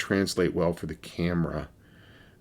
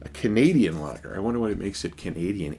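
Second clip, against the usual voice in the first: normal talking voice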